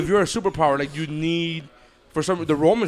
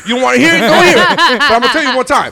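shouting the warning, louder; loud blunt warning; 6 semitones higher, 10 dB louder than usual. Retold or imitated. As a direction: insist loudly, laughing at the end. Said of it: "they're like".

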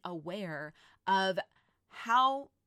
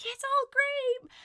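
chirpy mock cheer, much higher and slower; overly upbeat Pollyanna voice, pitched way up; mock positive Polly; 5 dB louder, slower than usual. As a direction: play the fake-cheerful optimist, high and sing-song. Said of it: "positive Polly, like".

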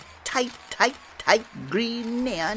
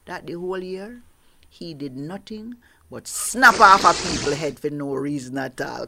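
plain reading voice

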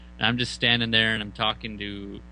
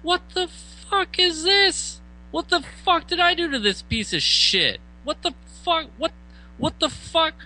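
outraged disbelief. Retold or imitated. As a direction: blurt it out in high-pitched disbelief. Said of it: "I was like".

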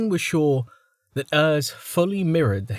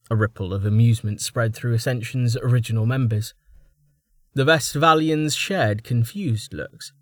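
ordinary delivery, nothing marked

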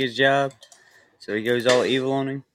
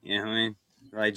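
ordinary talk, nothing marked

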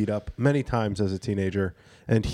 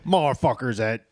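Bobby Johnson. Retold or imitated. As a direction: imitate a friend's heavy Philly accent. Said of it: "I started doing the voice".

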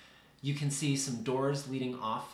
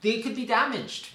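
high, loud, indignant scolding; pitched-up, exasperated character voice; scandalized researcher; 8 semitones higher, 6 dB louder than usual. Acted. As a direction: scold the visitor in a raised character voice. Researcher scolds a would-be vaper.

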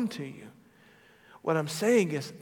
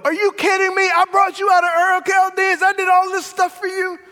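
much higher and louder, indignant outburst; mock outraged shout; 12 semitones higher, 10 dB louder than usual. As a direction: blast it like an exasperated boss. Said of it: "God didn't go".

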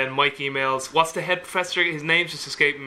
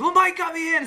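elated shout; 13 semitones higher than usual. Retold or imitated. James thanks the friend on the phone, overjoyed. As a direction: gush your thanks at the top of your voice. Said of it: "He goes".

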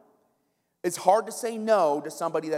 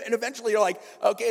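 ordinary talk, nothing marked

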